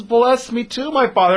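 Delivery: high, pleading mock cry; dramatised tearful plea, pitched high; anguished cry. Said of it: "he screamed".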